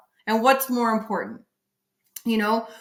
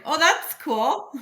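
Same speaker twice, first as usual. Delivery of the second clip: slow, higher mock-approval; sarcastic; 7 semitones higher, slower than usual. Mocking a husband who brags he provides, sarcastic. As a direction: drawl it out, pretending to be impressed.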